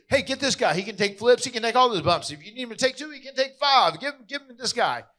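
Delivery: high, loud retold sales pitch; acting out pushy, high-pitched volunteering; eager pitching. Others eagerly volunteering his brother.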